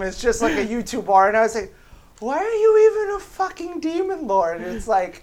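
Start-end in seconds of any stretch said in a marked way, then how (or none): 2.2-4.6 high incredulous outburst; exasperated. Retold or imitated. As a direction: ask it in disbelief, pitched way up. Exasperated quote of reaction.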